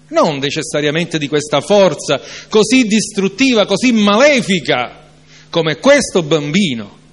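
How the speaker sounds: fervent preacher rebuking doubt; preaching at full force. Acted. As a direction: build the sermon to a passionate peak. Preacher's rising passage.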